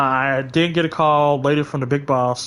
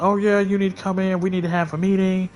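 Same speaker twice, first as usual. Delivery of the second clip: higher-pitched mock summons; imitated supervisors; 6 semitones higher than usual. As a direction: mimic the bosses in a raised pitch. Voices supervisors summoning her.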